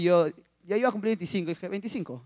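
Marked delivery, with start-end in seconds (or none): 1.7-2.2 put-on posing as older. Young suitor telling the pastor his age, quietly posing as mature. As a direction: say it softly, trying to pass for older. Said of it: "Para que me vea como que estoy más grande y mayor".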